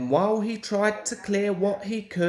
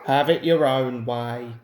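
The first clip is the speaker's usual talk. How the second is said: lower, put-on driver's voice; driver giving in; 5 semitones lower, 4 dB louder than usual. A narrator's reading. Driver giving in to Peter Sam, lower resigned voice. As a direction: shrug it off in a lower voice. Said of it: "said his driver".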